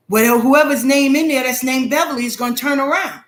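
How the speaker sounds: ordinary talk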